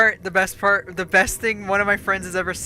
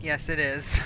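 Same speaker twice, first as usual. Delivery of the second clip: normal talking voice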